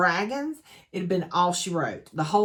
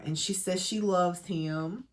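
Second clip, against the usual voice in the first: ordinary talk, nothing marked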